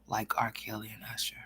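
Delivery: usual speaking voice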